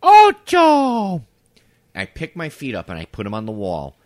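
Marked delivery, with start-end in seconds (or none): 0.0-1.2 high, blaring neighbor impression; loud Spanish counting. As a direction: call the number out piercingly, mimicking her. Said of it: "in loud Spanish".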